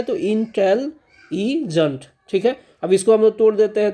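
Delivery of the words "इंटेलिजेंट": "इंटेलिजेंट" said slowly and carefully, each syllable clear; "इंटेलिजेंट" at half speed, every syllable clear; model pronunciation. Shown as a demonstration. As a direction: sound out "इंटेलिजेंट" slowly for the class. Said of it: "इस शब्द का सही उच्चारण क्या किया जाता है".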